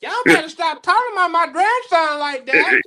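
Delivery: high, put-on grandma scolding; old lady voice. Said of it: "get in my old lady voice".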